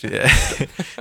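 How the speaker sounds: short laugh. Real, not acted. Self-deprecating laugh.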